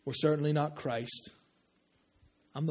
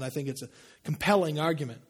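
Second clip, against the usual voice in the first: normal talking voice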